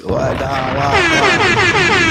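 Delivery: high pleading why rising to a shriek; mock-anguished cry over the wrong name; exasperated squeal. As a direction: wail the last why up high and loud. Mock outrage at Sizzler name.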